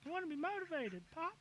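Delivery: put-on high-pitched little boy voice; kid voice. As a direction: play an eleven-year-old son, pitched high. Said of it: "I'm in character as a fucking kid".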